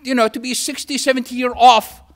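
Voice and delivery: usual speaking voice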